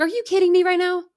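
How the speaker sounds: high, stunned disbelief; can't believe he did it; incredulous. Retold or imitated. Retold disbelief at husband.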